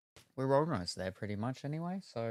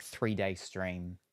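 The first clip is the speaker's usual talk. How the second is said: ordinary talk, nothing marked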